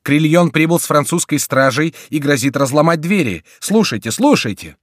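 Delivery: high, loud urgent report; breathless shout. A narrator's reading. Monk warning that Crillon threatens the doors, urgent cry. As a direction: blurt out the alarm loudly. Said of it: "кричал, запыхавшись, монах".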